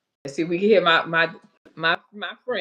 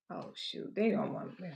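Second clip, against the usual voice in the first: whispered; 13 dB quieter than usual. Real, not acted. Audio failing on live stream.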